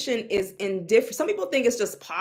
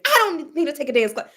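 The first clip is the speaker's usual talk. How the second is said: mimicking client; 2 semitones higher, faster than usual. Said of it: "I just went line dancing".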